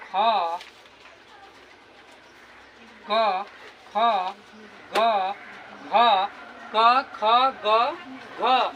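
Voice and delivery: क ख ग घ spoken loud and separate, each letter on its own; loud letter demo. Shown as a demonstration. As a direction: call out each letter clearly for the class. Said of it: "अच्छा, बोल कर देखो ना". (कंठ से ज़ोर से वर्ण बोलकर दिखाना)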